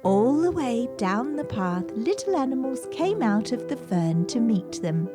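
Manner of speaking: plain reading voice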